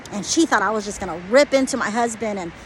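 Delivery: ordinary delivery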